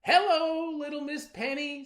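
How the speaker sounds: high, loud sing-song hello; butter her up; sly sweet greeting. Acted. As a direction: trickster rabbit sweet-talking the girl. Rabbit greets the girl.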